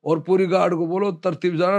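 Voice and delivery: ordinary delivery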